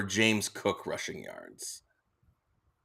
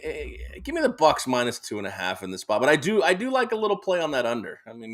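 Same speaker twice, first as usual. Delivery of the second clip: ordinary delivery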